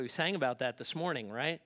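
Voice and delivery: ordinary talk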